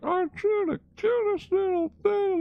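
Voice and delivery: put-on very high cooing baby talk; cooing Squirrel Girl. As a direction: coo at the cute little shark. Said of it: "Squirrel Girl talking to Jeff".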